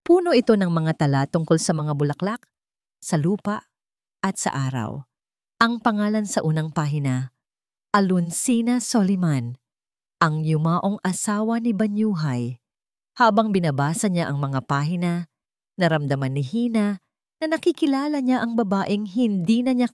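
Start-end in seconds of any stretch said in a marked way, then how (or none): none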